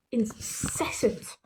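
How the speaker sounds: ordinary delivery, nothing marked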